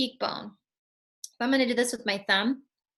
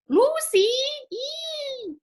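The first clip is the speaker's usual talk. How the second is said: screechy cat; much slower than usual. Pretend. Voicing moody cat muscles.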